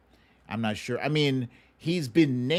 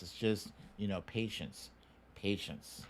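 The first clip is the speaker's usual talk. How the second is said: Patience said much softer, fading; 2 semitones lower, 10 dB quieter than usual. Real, not acted. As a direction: soothe the viewer's impatience quietly. Calming the impatient viewer.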